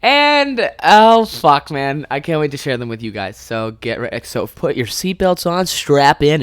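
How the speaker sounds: normal talking voice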